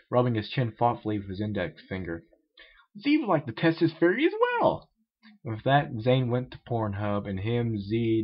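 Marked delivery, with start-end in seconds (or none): 3.0-4.9 odd character voice. Acted. Z speaks of himself.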